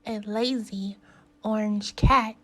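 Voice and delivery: usual speaking voice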